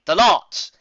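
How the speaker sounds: loud, punchy announcer call; mocking the narrator. Reader mimicking the dull documentary narrator, loud. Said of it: "kept saying".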